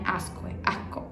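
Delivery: Asco with the s breathed out, barely voiced; aspirated s. Shown as a demonstration. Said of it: "fala asco". (asco com o S aspirado e sussurrado)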